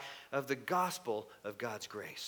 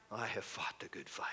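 whispered, reverent; hushed Paul; 6 semitones lower, 9 dB quieter than usual. Acted. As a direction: breathe Paul's words softly.

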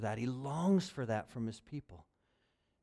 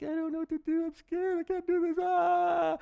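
high, panicky little bird voice; scared eaglet; 6 dB louder than usual. Acted. Preacher voicing a frightened eaglet under the wing, high panicky squeak. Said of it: "a little scared eaglet".

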